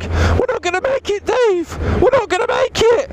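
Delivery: high panicked wail at the bike; mock panic. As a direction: shriek it in mock terror. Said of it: "sometimes even I'm like".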